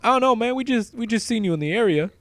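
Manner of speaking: high, loud shady dude voice; high shady guy. Retold or imitated. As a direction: play a twitchy dealer type. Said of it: "he's like".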